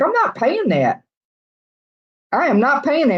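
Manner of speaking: high-pitched, emphatic refusal; indignant refusal. Retold or imitated. Retelling her reaction to crazy rent, indignant. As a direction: push back in disbelief at the price.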